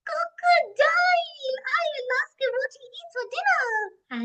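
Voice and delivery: high, loud gasping squeal, first word stretched; gasp, then cry it out high and thrilled; all excited. Acted. Boo Boo spotting the crocodile, all excited. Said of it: "Boo Boo was all excited".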